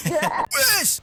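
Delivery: high laughing shout; startled laugh. Real, not acted. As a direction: laugh and yelp at the surprise. Surprise new animatronic.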